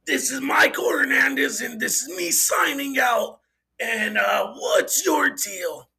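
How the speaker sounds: put-on host voice, projected sign-off; Doug impression. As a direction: mimic a show host signing off, stretching the last words. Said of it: "in a Doug voice".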